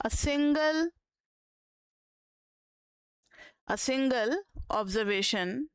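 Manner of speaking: slow dictation with long gaps; feed the definition out in small pieces. Acted. Teacher dictating the meaning of average, slow dictation.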